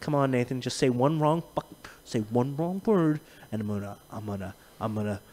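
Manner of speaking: soft and low, imitating a man waiting for an excuse; quiet impatient muttering. Host imitating Matt waiting for a wrong word, softly. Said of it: "It's like".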